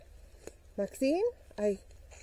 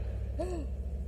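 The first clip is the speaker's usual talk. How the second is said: mock gasp; 6 semitones higher, 9 dB quieter than usual. Mock discovery of socks.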